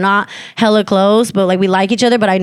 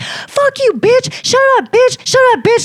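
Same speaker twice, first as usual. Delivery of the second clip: high-pitched re-enacted cursing rant; furious outburst; 14 semitones higher than usual. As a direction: let it all out at her, pitched way up. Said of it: "I just let it all to her".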